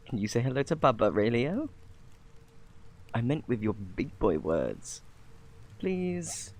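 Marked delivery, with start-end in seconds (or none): 5.8-6.5 pleading. Acted. Coaxing child to greet.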